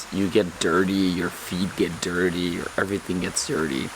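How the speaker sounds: ordinary talk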